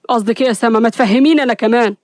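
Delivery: ordinary delivery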